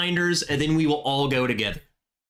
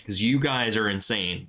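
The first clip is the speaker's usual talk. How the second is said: ordinary talk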